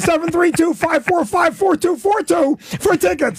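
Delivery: high, frantic phone-number plug; rapid-fire shouted number. Acted. Comic plugging his club tickets, frantic high shout. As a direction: rattle off the digits in a high yell. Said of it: "Say it again, slow".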